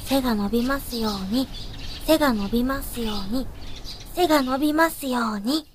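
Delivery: praying softly for a wish; quiet prayer. Acted. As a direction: murmur a little wish, repeated evenly. Repeated morning wish at prayer.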